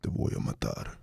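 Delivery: deep, unvoiced menacing whisper; low breathy threat, barely voiced; low magnetic voice. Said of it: "if you made it deeper".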